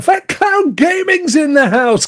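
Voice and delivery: high, slow announcer-style welcome; hype shout-out. Acted. Welcoming a viewer into the stream, hyped. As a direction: announce him like he's walking on stage.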